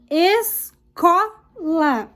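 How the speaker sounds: escola very slow, CO strongest; drawn-out "escola" with pauses between syllables; stressed syllable demo. Shown as a demonstration. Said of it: "pinte a sílaba tônica".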